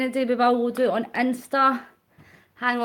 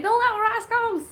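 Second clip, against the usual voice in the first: high excited cry; excited shout; 11 semitones higher than usual. Retold or imitated. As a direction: shout it like an eager crowd. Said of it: "Everybody's like".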